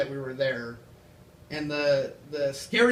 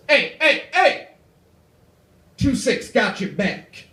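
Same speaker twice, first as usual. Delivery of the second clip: loud, higher shouted call of hey, slowing on the last words; gang guy shout; 4 semitones higher, 6 dB louder, slower than usual. Retold or imitated. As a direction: yell it after him down the steps. Gang guy calls after him.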